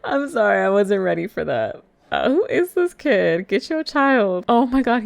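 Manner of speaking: high and loud, laughing in disbelief; exclaim, still cracking up; real amused disbelief. Real, not acted. Player reacting to the kid's stunt, loud amused disbelief. Laughing shock at in-game kid.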